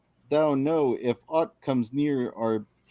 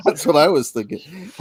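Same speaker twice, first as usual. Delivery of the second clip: talking through laughter; laughing; 3 semitones higher, 6 dB louder than usual. Real, not acted. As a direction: giggle the words out. Laughing at pirate voice.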